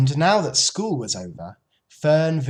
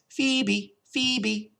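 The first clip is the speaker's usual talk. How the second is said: phoebe bird call; 9 semitones higher than usual. A narrator's reading. Phoebe on an apple branch calling its name, high chirp.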